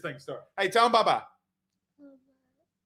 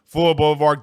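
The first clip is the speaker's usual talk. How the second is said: punchy sponsor read, firm and clear; 4 semitones lower than usual. Acted. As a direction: sell the shop like a local radio spot.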